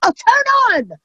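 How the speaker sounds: high-pitched, exasperated pleading with a stubborn device; exasperated yelling. Acted. Comic yelling at a phone that won't recognize her face, exasperated. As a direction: jump up high and scold it impatiently.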